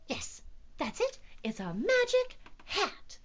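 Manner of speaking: high little cat voice; squeaky self-assurance. A narrator's reading. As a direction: squeak it, convincing yourself. Squeaky cat settles on idea.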